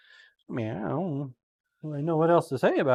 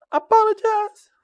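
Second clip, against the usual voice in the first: high-pitched apology; 5 dB louder than usual. Real, not acted. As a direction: apologize pitched much higher.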